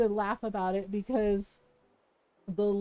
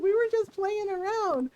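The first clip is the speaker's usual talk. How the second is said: put-on defensive kid brother; 7 semitones higher than usual. Scared little brother voiced.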